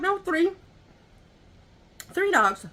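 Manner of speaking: the neighbor sets her straight; neighbor answering. Retold or imitated.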